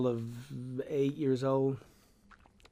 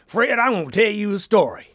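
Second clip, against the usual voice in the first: loud, higher booming storyteller; Jack Fling; 7 semitones higher, 11 dB louder than usual. Retold or imitated. Big store owner Jack Fling calling young Fred over, booming loud. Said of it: "Jack Fling himself, big barrel of a guy".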